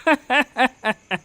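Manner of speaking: smug villain chuckle; a scheming neighbour's gloating laugh. Acted. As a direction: laugh at their misfortune. Villain gloats over the ruin.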